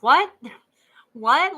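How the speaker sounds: loud, high incredulous what; shocked disbelief. Real, not acted. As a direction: blurt it out in stunned disbelief. Reacting to blanket time.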